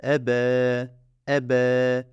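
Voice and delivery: E and B drawn out by one elif; slow drawn-out letter sounds; lengthened vowels. Shown as a demonstration. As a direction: stretch each sound a beat. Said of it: "bir miktar uzat".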